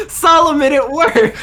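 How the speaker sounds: high, excited calling out; delighted exclamation. Acted. Suggesting Hazel turn back to Solomon, high and delighted. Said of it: "turn back to Solomon and go".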